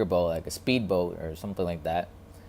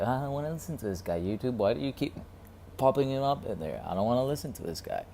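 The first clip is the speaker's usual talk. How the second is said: annoyed inner voice; 5 semitones higher, 3 dB quieter, faster than usual. Retold or imitated. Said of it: "and I was like".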